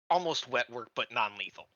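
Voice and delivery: normal talking voice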